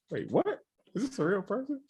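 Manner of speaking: confused caller. Retold or imitated. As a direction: act thrown by a strange call. Confused person answering robocall.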